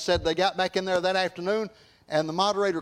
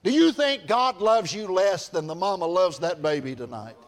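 ordinary talk

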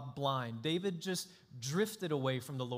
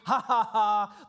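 slow, loud mock laugh "ha, ha, ha"; mocking laughter; 5 semitones higher, 9 dB louder, slower than usual. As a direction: laugh at the failed disciples, hammed-up.

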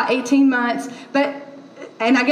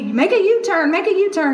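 slightly higher, lightly raised nagging navigation voice; mock shouting GPS; 5 semitones higher than usual. Pastor imitating the navigation app, mild mock shout. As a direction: nag like the Waze lady. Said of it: "like, shouting at me".

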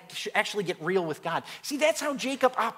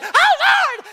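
very high, loud mock shriek; startled shriek; 9 dB louder than usual. Women startled by a yell behind them, mock shriek. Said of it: "when they heard me yell".

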